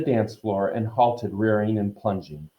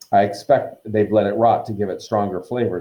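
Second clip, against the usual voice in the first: ordinary delivery, nothing marked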